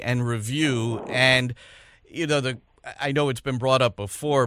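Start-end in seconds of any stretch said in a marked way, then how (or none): none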